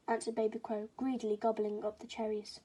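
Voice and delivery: plain reading voice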